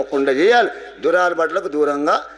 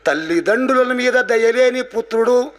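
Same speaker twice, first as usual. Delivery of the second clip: raised-pitch spoken verse; verse recitation; 6 semitones higher than usual. Shown as a demonstration. Teacher quoting a verse on thankless sons, reciting. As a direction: recite the line of the poem.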